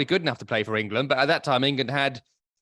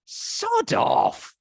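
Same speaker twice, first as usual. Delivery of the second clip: breathy, much higher throwaway brush-off; mock dismissive sod off. Reading the manager's farewell statement, a scornful whispered dismissal. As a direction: blow it off like a scornful aside. I just read it and I just thought.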